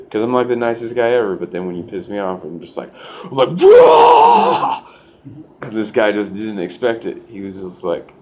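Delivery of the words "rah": long, high, very loud roar; enraged roar. Shown as a demonstration. Nice guy showing his hidden wrath, enraged roar. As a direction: explode into a full-throated battle cry. Shows how he explodes in rage.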